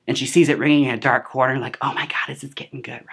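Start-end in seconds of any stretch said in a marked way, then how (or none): 1.8-3.0 whispered aside to a seatmate; conspiratorial cinema whisper; excited whisper. Retold or imitated. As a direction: whisper it, thrilled. Viewer's aside, retold.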